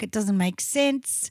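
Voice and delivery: ordinary talk, nothing marked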